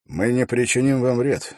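usual speaking voice

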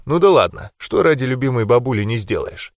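plain reading voice

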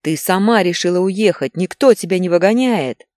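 loud, high husband's retort; yelling. A narrator's reading. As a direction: yell it back at your wife. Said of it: "орал Игорь". (муж орёт в ответ)

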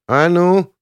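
high, loud warning cry; challenging shout. A narrator's reading. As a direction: burst in to break up a fight. Girl steps in to defend him.

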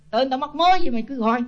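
high-pitched mock indignant yell at the nagging chanters; Buddha statue yelling back. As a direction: play the fed-up statue snapping back, pitched well up. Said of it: "ông la liền".

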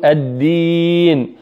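الدين with a firm voiced d and no breath; clean, airless d in الدين; correct clean d. Shown as a demonstration. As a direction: hold a solid doubled d in الدين, then a long ii. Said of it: "ليس فيها همس".

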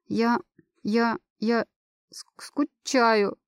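slow, stammering through sobs; crying on the phone. Acted. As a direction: choke the words out between sobs. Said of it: "начинаю реветь, рыдая в трубку, всхлипывая, заикаясь".

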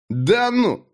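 high, sneering "Да ну!"; smirking. A narrator's reading. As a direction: smirk it in disbelief. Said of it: "ухмыльнулся Даг". (Даг ухмыляется, издевательски)

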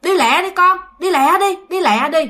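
scolding grandmother. Retold or imitated. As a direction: hurry the child along sharply. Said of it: "Thì ngoại cô mới la".

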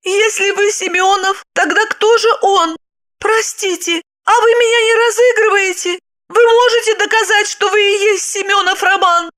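high-pitched, doubting questions; bewildered young woman. A narrator's reading. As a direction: question him in a high, confused voice. (растерянно, высоким женским голосом)

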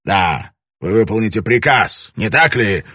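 loud menacing demand; growled demand. A narrator's reading. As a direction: growl it as a threat. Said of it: "прорычал он".

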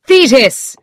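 high, loud call of a name; urgent cry. A narrator's reading. Doctor calling to the girl by the sickbed, crying out. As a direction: call out sharply in alarm. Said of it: "I cried".

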